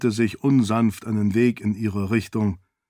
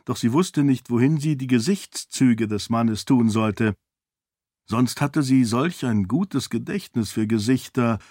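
ordinary delivery, nothing marked

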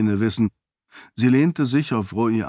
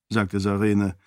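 usual speaking voice

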